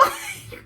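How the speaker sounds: high, trailing giggle; soft squeaky laugh. Real, not acted. Laughs at his vow.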